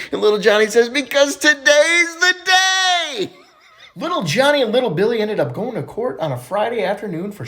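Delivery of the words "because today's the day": high, drawn-out gleeful cry; hammed-up elation. Johnny telling the therapist why he is happy, exaggerated excitement. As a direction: stretch the last word high in mock joy. Overjoyed Johnny reveals his yearly day.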